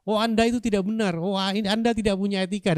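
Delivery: hammed-up forceful rebuke; harsh criticism. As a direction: act out an attacker accusing from the floor. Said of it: "orang yang mengkritik keras".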